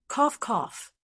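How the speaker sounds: short embarrassed fake cough; awkward cough. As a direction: clear the throat awkwardly.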